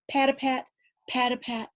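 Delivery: ordinary delivery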